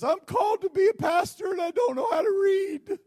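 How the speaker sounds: high, loud wailing near tears; crying man. Retold or imitated. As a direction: cry it out in despair. Man voiced, crying shout.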